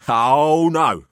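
lightly voiced worldly old gent; patronising older man. A narrator's reading. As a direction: talk down to them, amused. Pelham's voice in story.

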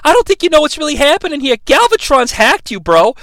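high, rushed bro explanation; earnest bro. Retold or imitated. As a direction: imitate a bro breaking it down urgently. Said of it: "He's like, "Bro".

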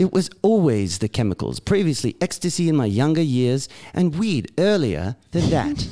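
put-on British reading, swinging intonation; British accent. Co-host reading Englishman's recovery email, British accent. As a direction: play the English letter-writer, British accent. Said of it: "read it in a British accent".

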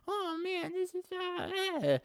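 very high, put-on mother voice; imitated mom voice. Mom at home talking to her son, caricatured high voice. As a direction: squeak out a mock mom complaint.

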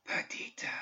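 a faint, breathy whisper of her name. A narrator's reading. Spirit calling Perdita from the dark, faint whisper. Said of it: "it whispered, so faintly".

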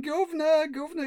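high Cockney cry of Governor; mock Cockney grief. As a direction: wail Governor like a grieving Cockney sidekick. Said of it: "venting his grief, going".

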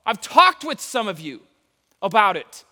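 loud, high-energy sermon; preaching. Acted. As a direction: preach it with fire. Preaching at full force.

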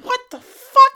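high, loud, incredulous shout; shocked outburst. Acted. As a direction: yell it in outraged disbelief. Shocked reaction to twist.

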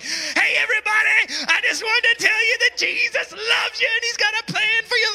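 high, loud mock call to a whole street; imitated street-preacher shout. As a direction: belt it out like your friend preaching on a corner.

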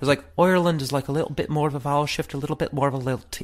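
high, lilting Irish accent. Shown as a demonstration. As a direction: slip into a lilting Irish brogue.

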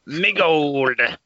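lightly voiced Irish leprechaun; a hint of the movie leprechaun. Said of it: "You sounded just like him".

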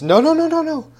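high, loud burst of rapid-fire objection; frantic villain cutting someone off; Urky Bafflestone. Acted. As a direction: cry out in high-pitched protest. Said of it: "Urky Bafflestone says".

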